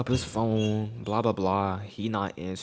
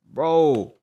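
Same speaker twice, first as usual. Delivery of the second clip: normal talking voice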